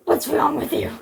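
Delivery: rough, husky hero's question; rasped demand; gruff hero. A narrator's reading. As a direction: growl it like an action hero. Narrator voices Snake, gruff hero.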